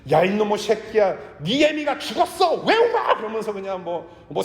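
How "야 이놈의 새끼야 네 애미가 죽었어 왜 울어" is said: shrill, much higher, rising into a shout; a hammed-up mother yelling at her crying child; over-the-top mock rage at a toddler; mock screaming mother. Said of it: "엄마가 하빈이한테 그렇게 리뷰할까요?".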